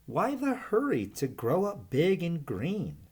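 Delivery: a curious little snake asking himself; puzzled young python voice; Verdi wondering. Acted. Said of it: "he wondered".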